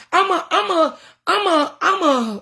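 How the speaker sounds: high, stammering, choking on tears; trying not to cry as the words catch; fighting back tears. Real, not acted. Announcing her live show, fighting back tears. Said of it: "oh God, I'm trying not to cry".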